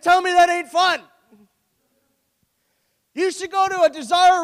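loud, high, full-force preaching; fired-up preaching. Real, not acted. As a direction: build the congregation up at full voice. Preaching crescendo on church fun.

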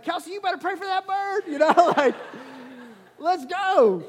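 high, loud, laughing urge; excited urging. Retold or imitated. Dad rushing his daughter downstairs to pray for the bird, excited and loud. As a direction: hurry the kid along, half laughing.

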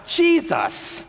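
high-pitched, drawn-out plea; high, pleading cry across a crowd. Acted. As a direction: call out as a blind beggar begging to be heard. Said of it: "he shouted that out".

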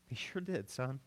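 a father's hushed tender agreement; tender reply. Retold or imitated. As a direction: say it quietly, moved by your son's words. Father answering son tenderly.

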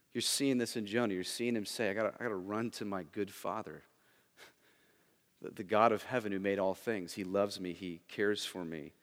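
soft, low, hushed pulpit voice; tender preaching. Acted. Preacher softly telling the church God loves him, hushed. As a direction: bring it down gently, almost to a hush.

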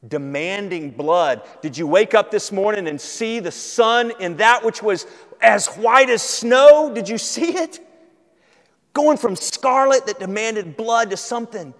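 rising into loud, high proclamation; building, impassioned preaching. Real, not acted. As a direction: climb to a loud, stretched peak, then press on. Preacher building to full force.

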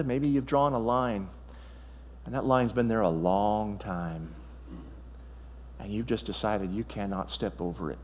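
a friend's quiet challenge to the congregation; gentle challenge. Real, not acted. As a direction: lean in and speak softly to them. Pastor challenges listeners as a friend.

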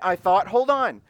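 ordinary delivery

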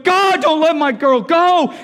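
high, loud desperate prayer; slow, full-voiced imploring shout; pleading yell. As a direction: cry out to God in desperation, stretching the words. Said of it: "He's just pleading. He's yelling".

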